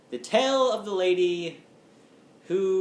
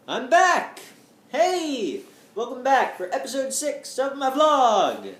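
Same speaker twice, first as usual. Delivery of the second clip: excited greeting; 6 semitones higher, 5 dB louder than usual. Real, not acted. Vlog greeting to viewers.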